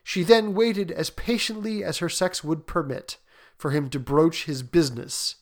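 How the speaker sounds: ordinary delivery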